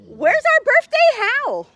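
high coaxing voice for the dog; high-pitched pet-talk question. Real, not acted. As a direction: lift the voice way up to coax a howl.

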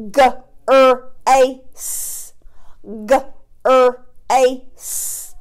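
B-er-ad stretched into separate sounds, slowly. Shown as a demonstration. Teacher sounding out a word for young pupils, stretched. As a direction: sound out B-er-ad segment by segment.

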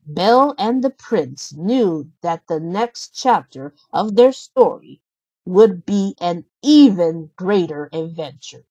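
ordinary delivery

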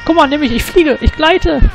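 high, sing-song gliding glee; playful high voice. Pretend. Gamer voices his gliding character in a high playful voice. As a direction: play it like a child flying.

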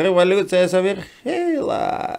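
slow orator's voice with a guttural burred r; Lenin with burred r. Retold or imitated. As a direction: declaim it slowly like a revolutionary leader, rolling r in the throat. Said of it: "прокартавил".

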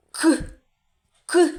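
very short hard "К"; isolated last sound. Shown as a demonstration. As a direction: pop out "К" by itself. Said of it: "який ви чули?". (короткий окремий твердий звук "К")

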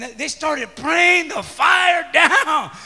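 loud, drawn-out preacher's shout; fired-up shout. Real, not acted. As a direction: surge with excitement, stretching the words. Retelling fervent prayer, voice surges.

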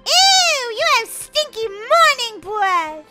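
high, drawn-out voice of disgust; disgusted character. Pretend. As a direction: recoil with a high, slow ew. Voices disgusted sibling or pet.

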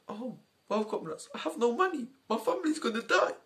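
soft, pitiful farmer lament, rising at the end; acted near-tears despair. Poor farmer lamenting having no money, acted near-tears. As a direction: plead quietly as if about to cry. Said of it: "I got a bit too much into character".

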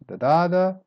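"Da da da" high and loud, mimicking word stress; weak, up, down. Shown as a demonstration. Teacher showing the pattern of predominant, "Da da da" with middle stress. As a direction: hum out the stress shape as "Da da da". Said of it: "Start weak".

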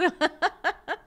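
loud, high peal of laughter; real laugh. Real, not acted. Teacher still tickled by the hair comment, laughing hard. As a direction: let the laughter burst out.

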